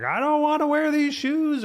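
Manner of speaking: high mock whine of a crying kid; whiny child. Dad mimics daughter crying in the car, high whiny complaint. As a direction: imitate a daughter fussing over shoes. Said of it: "screaming and crying and being like".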